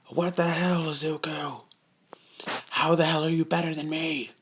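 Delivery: high, held-back mock shout; mock outraged yell. Bakugo trying to blow up Izuku, high quiet mock yell. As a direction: strain it high, as if yelling at reduced volume.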